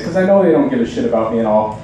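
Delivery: normal talking voice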